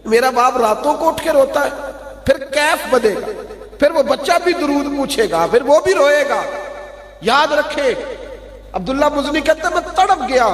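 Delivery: high, impassioned sermon climax; high-pitched fervent preaching; preaching at full force. Acted. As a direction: drive the congregation with rising fervour.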